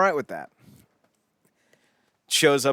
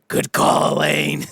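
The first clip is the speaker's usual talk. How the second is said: loud, rough holler down the street; mock distant bellow; shout from off-screen; 5 semitones higher, 6 dB louder, slower than usual. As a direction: call it out to someone walking away. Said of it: "you just hear".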